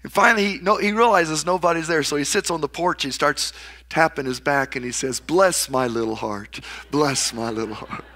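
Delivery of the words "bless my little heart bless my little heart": pat yourself and soothe gently; tender self-soothing. Retold or imitated. Little boy soothing himself on porch.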